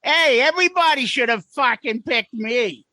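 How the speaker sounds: put-on rock star impression, pitched a bit up; playful mimicry of a rock guitarist. Host jokingly answers as the guitarist everyone should pick, impression. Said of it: "doing my [name] shit".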